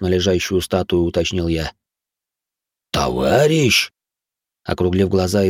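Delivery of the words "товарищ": drawn-out, aghast questioning; frightened exhale. A narrator's reading. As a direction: breathe it out slowly in alarm. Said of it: "испуганно выдохнул Тануки". (испуганно, протяжно выдохнуть)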